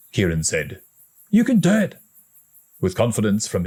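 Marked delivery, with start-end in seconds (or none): 1.3-2.0 high, gentle boost; encouraging. A narrator's reading. Big brother encourages his scared brother, high and gentle. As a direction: give him courage. Kieran voiced.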